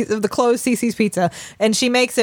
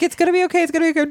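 much higher, cooing reassurance; soothing; 9 semitones higher, much faster than usual. Retold or imitated. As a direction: coo it to a scared kid. Mother soothing child.

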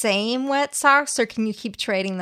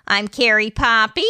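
ordinary talk, nothing marked

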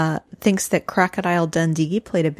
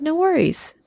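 light imitation; 10 semitones higher than usual. Retold coworker, raised pitch.

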